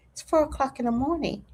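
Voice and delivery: usual speaking voice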